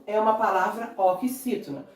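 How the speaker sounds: ordinary talk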